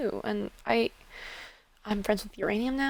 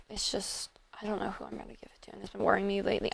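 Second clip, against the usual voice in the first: much softer, barely voiced; anxious whisper; 5 dB quieter than usual. Apologizing to friend after panicking, in a whisper. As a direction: whisper the worry, nearly gone. Distraught character after emotional outburst.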